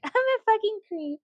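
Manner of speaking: high, mock creepy voice; creepy self-mock. Calling herself a weirdo, lightly creepy mock voice. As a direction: voice yourself as a playful creep. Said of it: "That sounded extremely creepy".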